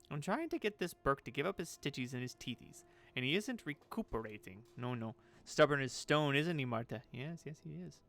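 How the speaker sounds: lilting batty crone grumbling; complain, then agree with yourself; swooping character voice, fussy self-talk; eccentric seamstress, exasperated. Acted. Voices Marta complaining to herself.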